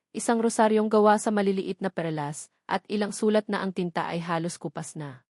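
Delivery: usual speaking voice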